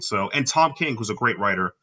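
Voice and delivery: usual speaking voice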